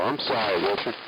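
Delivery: usual speaking voice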